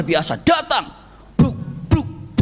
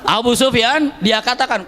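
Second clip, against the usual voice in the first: usual speaking voice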